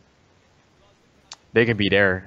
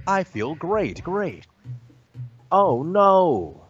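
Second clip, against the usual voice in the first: high, hammed-up commercial actor; cheesy canned voice; 8 semitones higher, slower than usual. Friend spoofing a cheesy commercial, high and hammy. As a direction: overplay it like a canned ad. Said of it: "why do you sound so cheesy".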